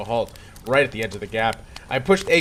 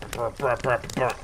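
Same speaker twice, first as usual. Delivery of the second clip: lip-buzzing garbled music; garbled music noise; 3 semitones lower, 4 dB quieter than usual. Retold or imitated. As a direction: buzz your lips like broken music. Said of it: "more garbled like brr".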